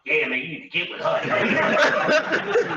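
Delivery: hammed-up spirit voice; mock father voice. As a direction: drag it out like the big father. The father figure speaks in a vision.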